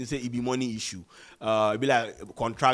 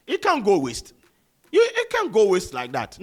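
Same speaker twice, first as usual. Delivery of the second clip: higher and louder, pleading with the label; insistent; 7 semitones higher, 6 dB louder than usual. Real, not acted. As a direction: push hard that it must come out. Insisting the song gets released.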